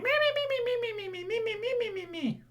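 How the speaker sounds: very high squeaking; lunatic pet squeak. Pretend. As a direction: squeak like you've gone mad. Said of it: "At which point I'll be like".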